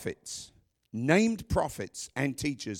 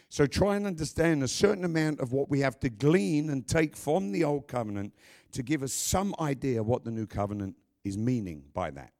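ordinary talk

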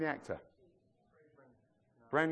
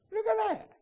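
high amazed squeal; childlike wonder. Acted. Preacher amazed at the blue sky, high squeal.

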